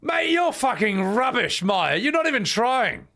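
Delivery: high, loud scolding; exasperated outburst. Pretend. Streamer berating Maya for not trying, exasperated yell. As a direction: rant at a useless pupil, pitch soaring.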